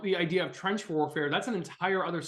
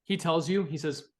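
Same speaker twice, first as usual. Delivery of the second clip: ordinary talk, nothing marked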